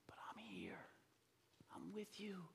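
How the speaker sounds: very soft fatherly whisper; tender reassurance. Retold or imitated. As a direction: whisper it close to comfort your child. Father comforting his daughter.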